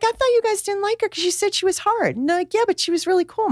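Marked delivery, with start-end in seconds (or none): 0.0-2.2 high-pitched mock protest; retold puzzled mom. Mom questions the kids about their late teacher, high puzzled retelling. 2.5-3.5 high-pitched lightly voiced kid; imitated kids' protest. Kids defend their teacher to mom, high imitated protest.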